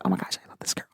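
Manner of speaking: breathy whisper of delight; hushed whisper to herself; whispered gush. Retold or imitated. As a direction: whisper it like a secret crush. Gushing inner thought quoted.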